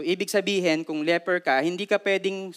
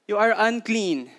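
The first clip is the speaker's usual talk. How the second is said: usual speaking voice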